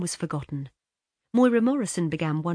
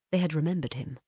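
ordinary delivery

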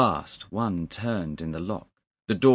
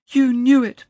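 very high, loud accusation; accusing cry; 13 dB louder than usual. A narrator's reading. Young man accuses the clerk, loud and distraught. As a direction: hurl it at him in distress.

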